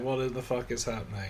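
soft, quick aside imitating a narrator; imitated narrating. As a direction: toss it off low-key, quickly, as mock commentary. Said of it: "he's narrating it".

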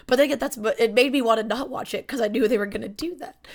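ordinary delivery

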